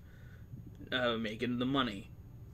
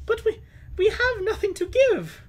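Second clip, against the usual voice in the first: frightened woman; 7 dB louder than usual. Acted. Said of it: "woman voiced in story".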